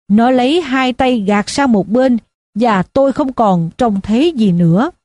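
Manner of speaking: ordinary delivery, nothing marked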